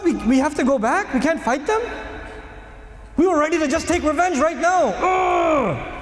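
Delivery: high, incredulous protest; outraged companions. Retold or imitated. Companions' outraged voice, retold by preacher.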